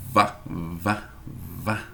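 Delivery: low soft vá with a buzzing v; voiced v demo. Shown as a demonstration. Teacher shows students where the v is made. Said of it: "é lábio inferior e dente superior".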